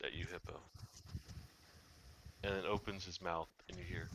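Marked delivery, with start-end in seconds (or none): none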